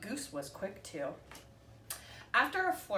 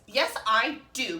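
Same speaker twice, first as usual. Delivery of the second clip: louder, slow and defiant; cried out; 3 semitones higher, 8 dB louder than usual. A narrator's reading. Goose insisting to Duck he knows eggs, slow loud protest. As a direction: dig in and call it out. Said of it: "Goose cried out".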